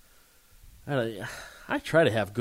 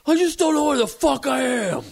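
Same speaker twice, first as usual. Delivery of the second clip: put-on high, lost drunk voice; drunk stranger; 14 semitones higher, 6 dB louder than usual. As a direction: play a bewildered drunk. Drunk man voiced in story.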